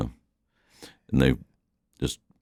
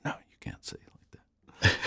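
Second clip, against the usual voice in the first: very soft, whispered imitation of a correction; hushed reprimand; 4 semitones higher, 12 dB quieter, faster than usual. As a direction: quote the scolding relatives under your breath. Retelling relatives correcting speech.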